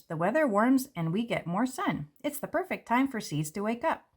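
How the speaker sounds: ordinary delivery, nothing marked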